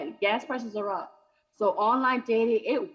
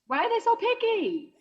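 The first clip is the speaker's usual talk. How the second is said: indignant; 10 semitones higher, 4 dB louder than usual. Acted. Indignant comic complaint.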